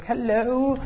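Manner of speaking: mock high-pitched, gushing hello; woman rushing up. Woman rushes at you to greet you, mock over-eager hello. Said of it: "She's right in your face".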